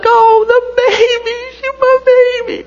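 high, teary mock-crying voice; hammed-up crying over baby shoes; imitating weepy wife. Wife weepy over baby shoes, mock crying in a high voice. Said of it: "She's like".

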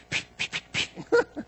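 quick voiceless puffs of punches; mock scuffle noises, breath only; imitated fighting noises. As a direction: puff out little fight sounds. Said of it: "They're in there just like".

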